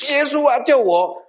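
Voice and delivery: high, loud cry for help, pitch leaping up; desperate shout. Retold or imitated. Dying man crying out to Jesus for rescue, loud desperate shout. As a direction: yell out to Jesus as if your last breath. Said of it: "我才大声喊叫".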